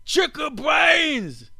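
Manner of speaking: high, slow, hammed-up Australian yell; over-the-top Australian caricature, shouted high; mock Australian accent. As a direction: belt it out like a cartoon Aussie bloke. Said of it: "do I sound like an Australian?".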